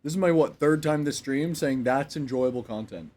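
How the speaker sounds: loud, slow insistence; emphatic. Real, not acted. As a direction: drag it out, pressing the point. Insisting loudly and slowly.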